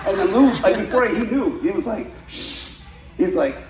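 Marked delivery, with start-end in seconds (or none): 2.3-2.8 soft, voiceless shush; imitated shush. Instructor hushing student before he lies, soft mock shush. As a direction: shush him quickly, all breath. Lou shushes him in the story.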